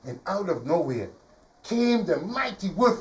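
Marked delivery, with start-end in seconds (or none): none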